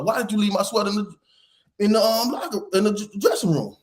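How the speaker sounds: high, fast exasperated demand; retold telling-off, stumbling over the words; scolding dad. Dad asks again about his sweater, exasperated scolding.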